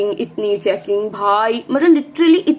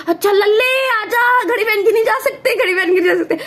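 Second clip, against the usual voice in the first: aunty voice; 10 semitones higher than usual. Retold or imitated. Aunty coaxes her son to remove his watch, high sing-song. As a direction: put on a fussy aunty's high coaxing tone. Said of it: "aunty कहती".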